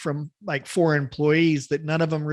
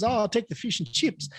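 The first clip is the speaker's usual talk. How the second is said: imitated New Zealander ordering, pitched up; casual joke Kiwi voice; Kiwi accent; 5 semitones higher, 4 dB quieter than usual. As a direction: put on a Kiwi accent for "fish and chips". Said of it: "I have a Kiwi one".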